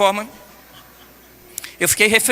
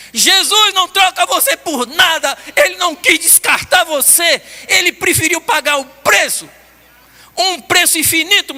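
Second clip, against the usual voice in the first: slower, louder preaching build; fervent preaching; 9 semitones higher, 4 dB louder, slower than usual. Acted. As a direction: drive it home to the congregation. Preaching climax.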